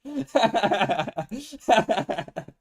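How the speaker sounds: a high, loud burst of giggles; high giggling burst. Real, not acted. Streamer laughing at chat's coin flip, high giggles. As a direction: crack up high at the result. Reacts to coin landing heads.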